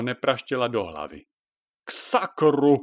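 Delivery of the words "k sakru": cursing a third time. Acted. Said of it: "zaklel potřetí".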